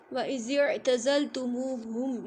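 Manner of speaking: slow chanted recitation of the verse; Quranic recitation. Shown as a demonstration. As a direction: recite the verse slowly and melodically. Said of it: "ये वाली आयत". (आयत की धीमी तिलावत)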